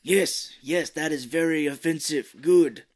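loud, hammed-up Japanese accent; fake Japanese accent. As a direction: imitate an approving master in mock-Japanese accent. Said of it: "why their Japanese is so bad".

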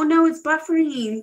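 normal talking voice

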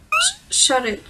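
stammering panicked shout; angry snap. Acted. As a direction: cut her off, panicked and angry. Character snaps at scared friend.

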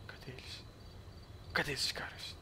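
hushed, sneaking whisper; a tense stalking whisper. Real, not acted. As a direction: breathe it so they can't hear. Sneaking up on enemy players.

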